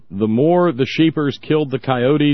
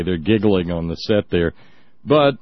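ordinary talk

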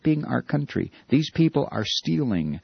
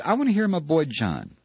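normal talking voice

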